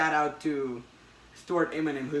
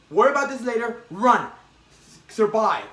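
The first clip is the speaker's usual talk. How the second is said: higher, louder urging; urgent shout; 6 semitones higher, 9 dB louder than usual. Retold or imitated. Retelling the comic, a voice urges Peter to flee. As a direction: push him to run now.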